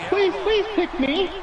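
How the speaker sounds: put-on high, slow pleading little boy; begging child. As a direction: beg the teacher like a desperate schoolboy. Johnny begging in the joke.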